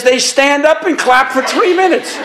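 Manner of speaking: can't hold back the laugh while quoting; giggling the punchline. Retold or imitated. Quoting priest through laughter.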